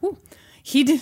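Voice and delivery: high, excited burst; real flustered excitement; got excited. Real, not acted. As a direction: blurt it out, carried away by the story. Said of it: "Got excited".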